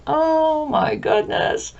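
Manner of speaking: high, slow gasp of discovery; mock amazed, high and drawn out; suddenly seeing it, high and slow; amazed realization. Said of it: "you're going".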